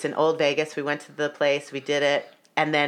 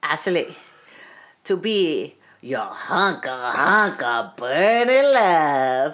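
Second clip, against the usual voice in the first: put-on Russian accent; much slower than usual. [name] officiant finishing the vows, slow mock Russian accent. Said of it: "with his Russian-ass accent".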